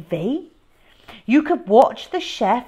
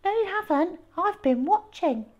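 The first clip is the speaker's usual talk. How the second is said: put-on high prissy child voice, quick; goody-goody smugness; prim little brother; 10 semitones higher, faster than usual. A narrator's reading. Peter asking Mum for a reward, high prissy voice.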